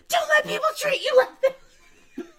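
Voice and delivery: high, loud yell while laughing almost to tears; laughing shout. Retold or imitated. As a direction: shout it out, cracking up.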